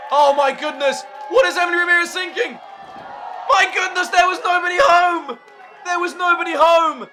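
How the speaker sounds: high, loud disbelieving yell; astonished shouting. Real, not acted. Commentator reacting to the crash through the board, astonished yelling. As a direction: shout it like you can't believe what you saw.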